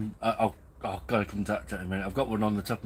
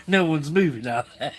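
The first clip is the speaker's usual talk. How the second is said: laughing through the quote; 7 semitones higher, 7 dB louder than usual. Retold or imitated. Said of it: "He goes".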